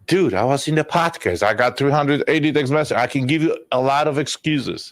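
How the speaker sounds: mock defensive dad. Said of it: "mocking the excuse-making dad".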